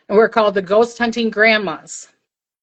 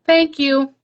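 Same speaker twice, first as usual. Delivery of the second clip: lightly voiced small lady spirit, higher; a hint of a squeaky little child voice; imitating a grateful little ghost lady; lady's little voice; 5 semitones higher than usual. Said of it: "in this lady's little voice".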